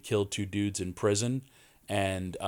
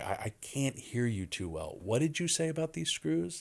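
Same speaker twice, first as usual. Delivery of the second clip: feigning deafness; 6 semitones higher than usual. Retold or imitated. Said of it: "he goes".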